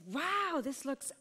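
high, excited acted "wow"; awestruck amazement. Shown as a demonstration. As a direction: play amazed in an improv demo. Demo of directed improv game.

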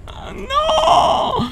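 a high shriek of dismay; sudden high yelp of dread; alarmed cry. Real, not acted. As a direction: shriek as the trap springs. Boss suddenly appears.

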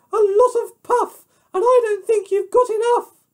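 very high, loud piggy falsetto; taunting pig. Acted. Pig mocks the wolf's puff, high and squeaky.

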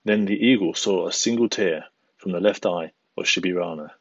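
usual speaking voice

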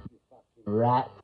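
low, scornful jeer at the rat; contemptuous jeer. Retold or imitated. Telling viewers what they call a grass, low scornful jeer. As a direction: spit the insult out low and scornful. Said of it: "As we call him".

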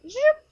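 high, loud zoop like a quick pen stroke; pen swoosh sound. Shown as a demonstration. Teacher makes a high, loud swoosh for a quick pen stroke. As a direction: zip the sound up sharply like a pen mark. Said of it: "took their pen and went".

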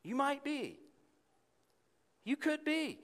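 high, wavering unsure voice; hesitant God voice. Retold or imitated. Preacher voicing a God who seems unsure of us. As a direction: sound doubtful and uncertain. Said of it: "And he might be saying".

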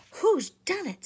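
high loud demand; alarmed cry. A narrator's reading. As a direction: shout it out in alarm. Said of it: "said Susan".

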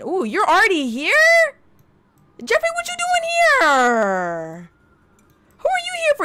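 shocked he showed up uninvited; surprised. Real, not acted. Surprised guest arrives.

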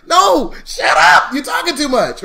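high, loud, drawn-out scolding; exasperated shouting. Acted. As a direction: shout the rambler down. Shouting down the imagined rambler.

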